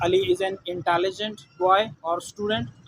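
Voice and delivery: slow, careful example sentence; deliberate teacher dictation; slow example read. Shown as a demonstration. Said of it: "example sentence read slowly".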